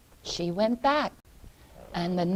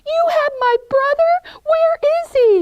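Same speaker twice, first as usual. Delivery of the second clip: very high, loud alarm; horrified Gretel; 11 dB louder than usual. Acted. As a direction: cry out shocked for your brother.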